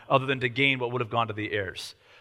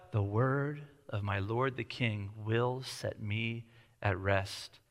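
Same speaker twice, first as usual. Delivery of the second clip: much softer, slow, lower; sly soft reading; 7 semitones lower, 11 dB quieter, much slower than usual. Shown as a demonstration. Preacher reading the widow's flattery slyly, soft. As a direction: breathe it out, coaxing. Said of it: "notice the way in which I read this".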